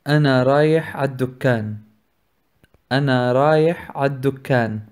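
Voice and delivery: ordinary talk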